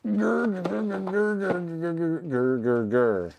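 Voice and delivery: playful frustrated growling, fading out; mock growl. Real, not acted. Forced to reshuffle games, growls at the shelf.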